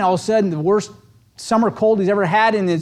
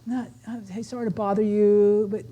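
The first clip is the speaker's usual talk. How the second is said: much softer, timid caller; meek apology; 6 dB quieter than usual. Retold or imitated. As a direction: apologize softly like an unsure cold caller. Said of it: "I'm like".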